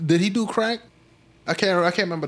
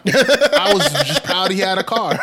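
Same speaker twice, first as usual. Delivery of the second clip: louder, laughing through the words; real amused laughing; 3 semitones higher, 5 dB louder than usual. Real, not acted. Comic admitting he mocked his friend's car, laughing. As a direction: crack up while confessing. Cracks up at his own hypocrisy.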